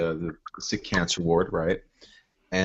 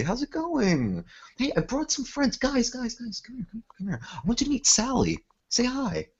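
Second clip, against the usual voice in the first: put-on very high, bright girl voice; light up with delight at the visitor; cheerful sick girl. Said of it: "her face kind of lights up".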